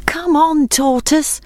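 high, worried calling out; anxious call. Acted. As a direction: cheer him on nervously. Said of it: "called his friends anxiously".

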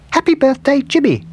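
very high, loud little cartoon voice; gingerbread man voice. A narrator's reading. Gingerbread man reading the cake's writing, high little voice. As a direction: read it out in a tiny high squeak.

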